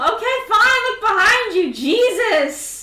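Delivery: high, loud giving in; exasperated. Acted. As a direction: snap it out loudly, fed up. Exasperated game master gives in.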